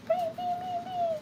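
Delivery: very high, chimed bings; playful high chime; sing-song bing. Real, not acted. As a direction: chime it like a bell. Sing-song sound effect.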